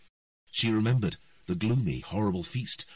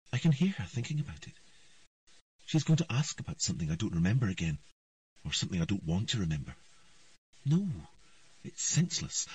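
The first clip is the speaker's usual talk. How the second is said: lightly voiced girl, higher, quick and stubborn; a girl talking herself out of remembering; young Ciri's voice; 6 semitones higher, faster than usual. A narrator's reading. Ciri's inner voice, child.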